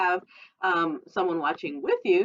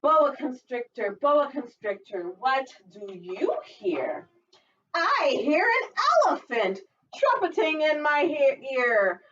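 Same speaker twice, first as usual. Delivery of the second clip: put-on sing-song read-aloud for little kids; storytime reading; 8 semitones higher, slower than usual. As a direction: read it like a lively picture book. Teacher reads picture book aloud.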